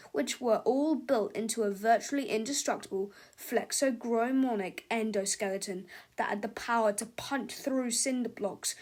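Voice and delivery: ordinary delivery, nothing marked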